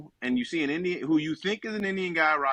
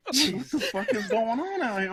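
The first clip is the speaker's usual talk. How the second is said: laughing disbelief; 6 semitones higher, slower than usual. Retold or imitated. Baffled at the gas station.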